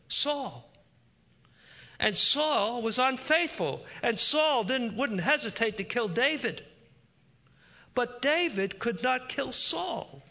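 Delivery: high, loud impassioned sermon; fervent preaching. Acted. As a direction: preach it with rising fire. Preaching builds to full force.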